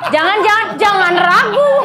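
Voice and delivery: nada tinggi sambil tertawa; pura-pura menolak, lalu plesetkan dengan kocak; pelesetan penolakan. Acted. Pelesetan penolakan setengah hati, tawa. (penolakan bercanda bernada tinggi)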